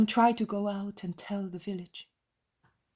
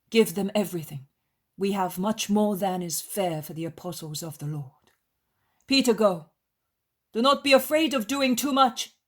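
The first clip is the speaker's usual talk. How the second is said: firm, kindly master's voice, growing louder; Jesus instructing disciples; 3 semitones higher, 7 dB louder than usual. Acted. Jesus sends disciples to the pilgrims and poor, assured instruction. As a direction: direct them with rising authority.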